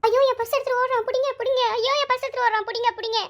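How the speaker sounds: shrieking after the purse snatcher in panic; crying for help. Acted. Girl yells for help as thief runs, high panicked shouts.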